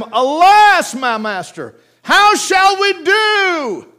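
alarmed servant's cry, high and loud, drawn out slowly at the end; panicked servant. Acted. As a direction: wail it like a terrified servant. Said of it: "his servant said unto him".

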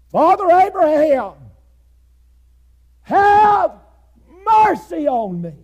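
high desperate shout for mercy; anguished cry. Acted. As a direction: cry out to Abraham from the flames. Preacher voices rich man in hell.

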